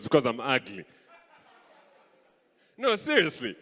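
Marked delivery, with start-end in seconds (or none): none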